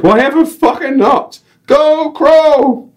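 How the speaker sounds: very high, loud North English cheer; North English guide. Acted. Guide joins the cheer, broad North English accent. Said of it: "random North English accent".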